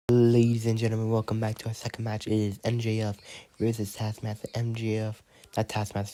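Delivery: lively ringside play-by-play, rattled off; wrestling commentary. Acted. Announcer calling a toy wrestling match, lively. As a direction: call the action like a TV announcer.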